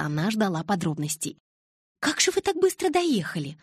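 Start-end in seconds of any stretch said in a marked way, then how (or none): 2.0-3.6 high-pitched, disbelieving question; incredulous. A narrator's reading. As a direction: question it in high-voiced doubt. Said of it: "недоверчиво спросила подруга".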